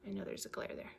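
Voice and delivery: whispered, very soft aside; hushed aside. Real, not acted. Storyteller apologising to viewers for a glare, hushed. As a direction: slip it in under your breath.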